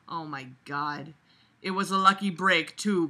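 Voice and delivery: ordinary talk, nothing marked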